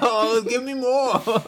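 high, slow gloating cartoon voice; Cartman. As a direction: greedily savour someone's misery, hammed up. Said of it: "Andrew is basically Cartman licking the tears".

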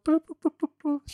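trumpet imitation. Mock trumpet fanfare.

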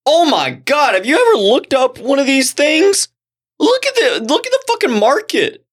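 high-pitched, astonished discovery; amazed excitement. Real, not acted. Showing co-host a surprising online find, amazed and excited. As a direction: gush in disbelief, voice way up.

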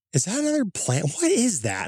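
high, alarmed questions; startled disbelief. Retold or imitated. Driver seeing strange green light asks, high and alarmed. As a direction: stare at a light that shouldn't be there.

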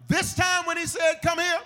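high, loud emphatic pulpit delivery; preaching shout. Real, not acted. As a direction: drive the warning home at full voice. Preaching at full force.

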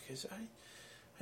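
whispered aside; whispering. Real, not acted. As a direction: drop to a whisper. Aside during live radio chat.

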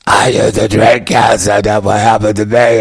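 lightly voiced strained rasp; raspy cancer-patient voice. Comic imitating a TV guest who lost his voice, mild strained rasp. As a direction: push the words out strained and raspy. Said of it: "it was just his voice".

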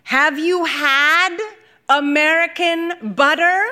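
high, slow, arms-wide showpiece question; grand question. Acted. Lula to the aunties, slowly and grandly. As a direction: stretch each word out like a big reveal.

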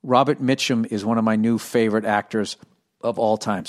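ordinary talk, nothing marked

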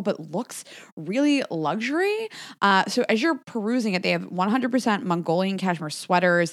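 upbeat sponsor read. Acted. Host to listeners, a lightly upbeat ad read. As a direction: sell the gift idea.

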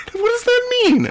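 high, laughing disbelief; incredulous laugh. Real, not acted. Host reacting to a personal ad, incredulous laugh. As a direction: ask through a laugh.

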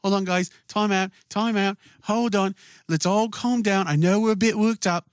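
high, strained pleading for a time out; calm a brawl in a high put-on voice; hammed-up nervous appeasing; imitating frantic peacemaker character. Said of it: "He's like".

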